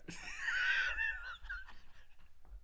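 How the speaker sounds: very high squealing real laugh. Real, not acted. Host giving up on the clip, shrieking with laughter. Laughs after "I had enough.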